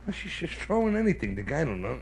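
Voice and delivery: ordinary talk, nothing marked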